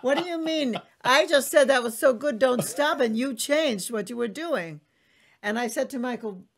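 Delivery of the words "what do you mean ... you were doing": high, loud, exasperated protest; indignant complaint. Retold or imitated. Complaining to her lover who changed course, exasperated. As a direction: scold him in disbelief. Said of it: "I would go like".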